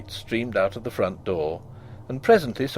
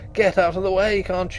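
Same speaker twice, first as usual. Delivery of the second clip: sharp shout, louder and higher; 7 semitones higher, 4 dB louder than usual. A narrator's reading. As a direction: yell him out of the way.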